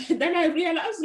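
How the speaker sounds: high, loud laugh breaking into words. Real, not acted. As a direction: laugh as you say it. Laughing at the misunderstanding.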